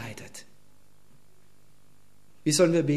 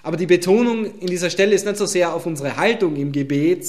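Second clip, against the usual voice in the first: usual speaking voice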